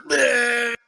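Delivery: high, drawn-out retching bleat; imitated puking. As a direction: mimic a friend throwing up. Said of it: "he was just like".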